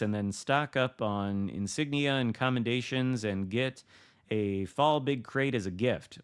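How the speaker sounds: ordinary delivery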